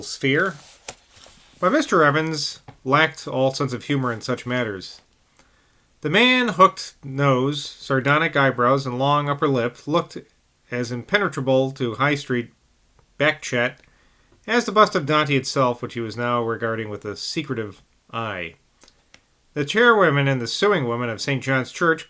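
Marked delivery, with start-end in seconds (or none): none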